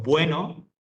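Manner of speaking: "bueno" drawn out slowly, "bue" kept as one syllable; diphthong example. Shown as a demonstration. Teacher showing a diphthong to students, drawn out as example. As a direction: stretch "bueno" with u and e glided together. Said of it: "ese bue es una sola".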